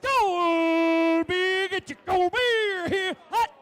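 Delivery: loud, high, slow vendor shout; stadium vendor hawking. Shown as a demonstration. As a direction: hawk it to the whole stadium. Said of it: "I was expecting more like".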